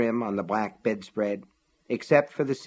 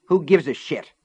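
snapping; 5 semitones higher, 5 dB louder than usual. A narrator's reading. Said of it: "Cora snapped".

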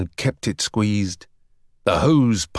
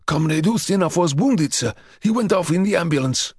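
breathless; 6 semitones higher than usual. A narrator's reading. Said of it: "breathlessly told him".

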